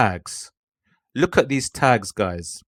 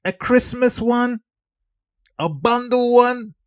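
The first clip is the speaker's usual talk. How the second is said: hype intro; 10 semitones higher than usual. Acted. Creator greets digital product family, high hype announcer voice. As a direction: pitch it way up and stretch the line endings.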